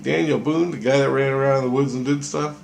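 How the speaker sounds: can't quite believe it, pitch it up; disbelief. Retold or imitated. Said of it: "And I'm like".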